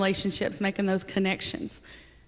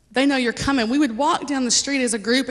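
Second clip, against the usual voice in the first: ordinary delivery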